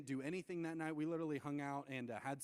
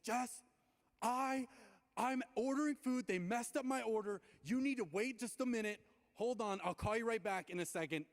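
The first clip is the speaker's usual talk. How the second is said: high, raised, exasperated retort; losing it; 7 semitones higher, 4 dB louder than usual. Retold or imitated. Telling Jess to wait while ordering food, losing it. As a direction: snap at her with raised pitch and volume. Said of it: "I lose it. So I was like".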